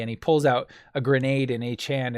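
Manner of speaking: ordinary talk, nothing marked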